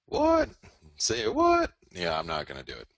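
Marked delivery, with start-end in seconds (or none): 0.0-1.7 very high, loud squealed disbelief; squeaky mock surprise. Mocking his dollar call in a squeaky voice. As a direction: shriek it like a startled cartoon.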